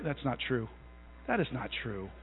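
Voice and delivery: quiet, dry denial he doesn't mean; mock-deny it under your breath; sarcastic. Preacher teasing the congregation, dry sarcastic denial. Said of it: "That was sarcastic".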